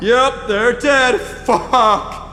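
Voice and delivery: loud, high, drawn-out panic; horrified outburst. Acted. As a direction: cry it out slowly in horror. Confirms bodies are dead.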